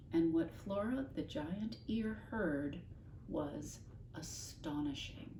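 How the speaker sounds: usual speaking voice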